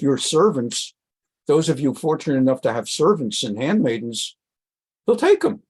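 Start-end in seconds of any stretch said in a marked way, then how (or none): 5.1-5.6 emphatic. Shown as a demonstration. Teacher emphasizing the king's seizure.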